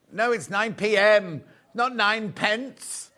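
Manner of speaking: slow, stressing P M against pence; correcting stress. Real, not acted. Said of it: "corrects a misreading".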